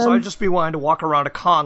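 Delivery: normal talking voice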